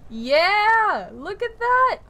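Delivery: high, loud whoop of delight; excited cheer. Real, not acted. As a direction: cheer in thrilled amazement. Delighted at spell result.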